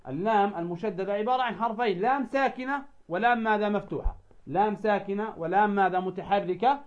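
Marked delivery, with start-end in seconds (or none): none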